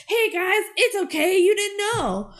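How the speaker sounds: high, bubbly, forgiving voice; cheery Brink. Retold or imitated. Brink forgiving his teammates in a high, bubbly voice. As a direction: gush it like a sunny cartoon hero. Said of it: "And Brink goes".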